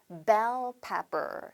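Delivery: bell pepper drawn out very slowly, bell hit hardest; slow, stress on bell. Shown as a demonstration. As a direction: lean on bell and stretch it out. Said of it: "영어로 뭐라고 한다". (bell에 강세를 주고 아주 천천히)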